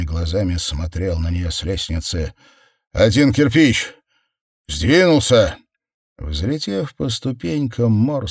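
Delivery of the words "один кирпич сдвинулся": loud, excited announcement; high, urgent call. A narrator's reading. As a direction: shout the news in punchy bursts. Calling the news across the house.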